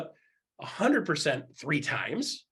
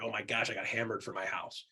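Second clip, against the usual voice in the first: mock groaning buyer; 7 semitones lower, 7 dB quieter than usual. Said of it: "they're like".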